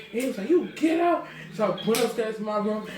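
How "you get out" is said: high, sharp command; angry order. Retold or imitated. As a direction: throw her out. Father ordering her out.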